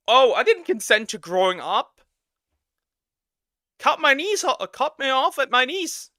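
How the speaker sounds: loud, high hammed-up complaint; mock outrage. Mocking someone who didn't consent to growing up, hammed-up wail.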